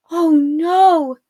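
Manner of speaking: sharp alarmed gasp of words. A narrator's reading. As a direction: breathe it out in sudden alarm. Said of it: "Arella gasped".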